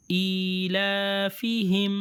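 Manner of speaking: ایلافہم read slowly, long vowels held; slow reading demo. Shown as a demonstration. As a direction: stretch ایلافہم. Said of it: "standing کسرہ ہے".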